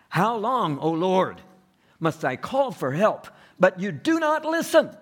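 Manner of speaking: high, loud pleading cry to God; anguished cry. Acted. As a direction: cry out like a desperate prophet. Preacher voices Habakkuk's anguished cry.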